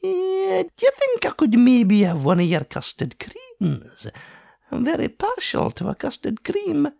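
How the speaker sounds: high Scottish voice, suddenly eager and sweet-toothed; high Scottish painter cheerfully begging a custard cream; brightened Scottish artist. A narrator's reading. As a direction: wheedle for a biscuit, cheered up. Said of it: "and he brightened".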